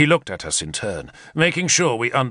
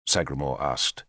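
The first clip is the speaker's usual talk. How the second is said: usual speaking voice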